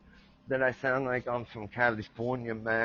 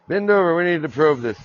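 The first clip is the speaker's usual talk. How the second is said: mock command; 4 semitones higher, 9 dB louder, faster than usual. Mock probe joke, comet.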